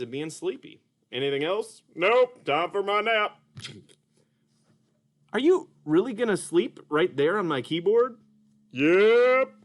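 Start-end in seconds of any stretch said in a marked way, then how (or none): 1.9-3.4 high, slow cartoon puppet voice; Mister Dolphin puppet. Puppet greets the kids as Mister Dolphin, high and slow. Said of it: "Mister Dolphin". 8.7-9.5 high, slow cartoon puppet voice; Mister Dolphin puppet. Puppet greets the kids as Mister Dolphin, high and slow. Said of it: "Mister Dolphin".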